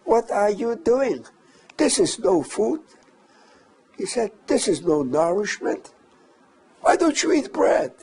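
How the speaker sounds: high-pitched mock angry shout at the prisoners; retold shouting. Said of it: "then he started shouting".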